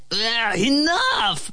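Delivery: bellowing. A narrator's reading. Ant cuts off flea's rant, mock bellowing. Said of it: "bellowed the ant".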